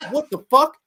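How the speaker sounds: high, loud mock disbelief; startled outburst, replayed; retold startled reaction. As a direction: act out being caught off guard. Acting out his startled reaction.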